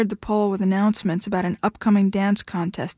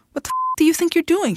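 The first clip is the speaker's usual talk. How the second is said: higher-pitched, outraged demand; indignant demand; 8 semitones higher than usual. A narrator's reading. Woman to the man who threw her against a pole, indignant demand. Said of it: "she demanded".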